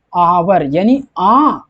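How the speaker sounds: "आवर" drawn out very slowly, then "आ" held long; slow vowel demo. Shown as a demonstration. Teacher showing students the opening vowel, very slow. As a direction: stretch "आवर" and the vowel "आ" out for the class. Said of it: "यहाँ पे देखिए आवर".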